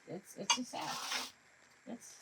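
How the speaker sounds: ordinary delivery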